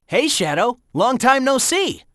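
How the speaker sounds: ordinary delivery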